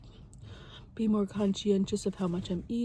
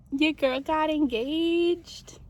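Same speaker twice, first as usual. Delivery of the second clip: slow, higher, louder reveal; excited; 8 semitones higher, 5 dB louder, much slower than usual. Real, not acted. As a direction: announce big news with delight. Announcing her engagement.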